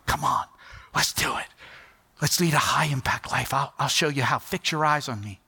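inviting whisper. Retold or imitated. Jesus calling a follower.

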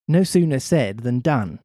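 ordinary delivery

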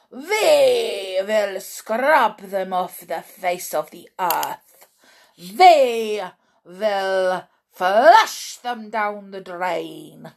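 slow, loud witch voice, hissed then thundering; a menacing witch spitting out each threat; Grand High Witch. Acted. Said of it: "screamed the Grand High Mi".

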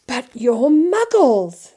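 loud, drawn-out wonder; thrilled amazement, pitch lifted; delighted. A narrator's reading. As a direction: marvel at them, stretching the words. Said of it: "said Mister Weasley delightedly".

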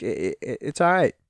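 ordinary talk

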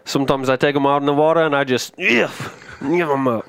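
quick Aussie bushman voice; hammy Crocodile Dundee impression; Australian accent. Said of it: "Chase's evil twin, Chase Dundee".

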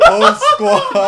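very high, loud, stretched-out holler; mock rallying call. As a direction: belt it out like a squad cry through laughter. Repeats imitated catchphrase over protests.

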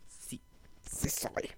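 breathy whisper admitting. Pretend. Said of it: "Y yo".

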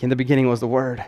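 mock-solemn recital of John one; solemn scripture reading voice. Shown as a demonstration. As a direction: intone the verse like a grand reading. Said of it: "I don't know why I'm talking like that".

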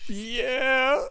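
high, drawn-out whimpered yeah; high wail of mock despair; mock crying. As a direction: whine it like a fake sob. Reacting to a bad dice roll.

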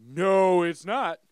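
loud mock-exasperated denial; retold objection. Retelling his reply to a wrong friend, loud objection. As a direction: overrule a friend loudly. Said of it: "I'm like".

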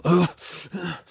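high huff and puff; exasperated puff of breath, mimicked; huffing like an annoyed passenger; imitated huff. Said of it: "huffing and puffing a little bit".

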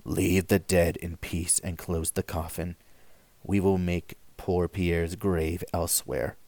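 put-on old priest quietly ordering the coffin closed; solemn priest. A narrator's reading. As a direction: speak like an old priest laying the dead to rest. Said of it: "said the cure".

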